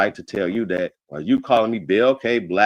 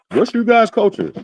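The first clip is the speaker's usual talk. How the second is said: mocking quote; 12 semitones higher, 4 dB louder than usual. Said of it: "I hate that conversation".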